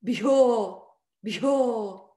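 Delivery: Bhyo with a breathy bh, long falling o; aspirate and long. Shown as a demonstration. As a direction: say Bhyo with a strong puff of air, held long. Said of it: "Aspirate and long".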